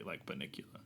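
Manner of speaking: hushed creepy whisper; spooky whisper. Acted. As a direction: breathe it out like a ghost story. Spooky comparison to Bunnicula.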